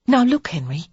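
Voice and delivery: put-on a woman coaxing a boy to watch; Lily's voice. A narrator's reading. Lily showing Henry a card trick, lightly voiced.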